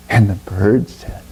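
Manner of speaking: ordinary delivery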